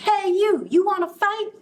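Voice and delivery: grouchy ladybug. Acted. Ladybug calling out to the elephant, high and cocky. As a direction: shout up at the big beast. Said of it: "the grouchy ladybug ... said".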